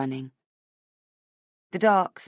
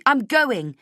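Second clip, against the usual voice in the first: raised, louder determined voice; firm declaration; 8 semitones higher, 6 dB louder than usual. Acted. Heroine to guard, raised and determined. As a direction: push the words out hard.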